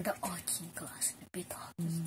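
soft unvoiced whisper. Real, not acted. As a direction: whisper it like a secret. Whispered hint about pet.

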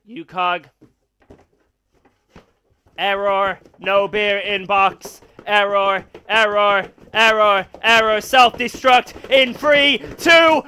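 slow, flat robot voice through a vocoder. Pretend. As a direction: speak like a glitching machine. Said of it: "I'm gonna turn on the robot".

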